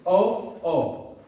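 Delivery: ordinary delivery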